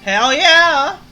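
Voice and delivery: loud, high tipsy whoop; cheering with drunken gusto. Acted. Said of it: "to make the drunk voice".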